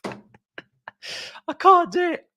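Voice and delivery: high, laughing "I can't do it"; laughing meme quote. Retold or imitated. Fan repeats the meme over the clip, laughing high. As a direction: giggle through the meme line. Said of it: "let me find that audio".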